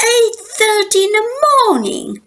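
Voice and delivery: put-on children crying out in dismay; upset cry. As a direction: wail it like kids who hate mornings. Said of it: "cried brother and sister".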